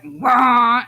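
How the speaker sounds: loud, drawn-out mock duck wail; dying duck squawk. Mother retelling a noise heard while working, dying duck squawk. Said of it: "like a dying duck".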